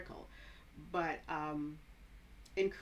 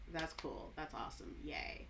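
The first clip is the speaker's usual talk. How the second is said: low, soft deadpan with no enthusiasm; sarcasm; 4 semitones lower, 9 dB quieter than usual. Shown as a demonstration. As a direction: say praise drily, dropping pitch and volume. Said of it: "That's sarcasm".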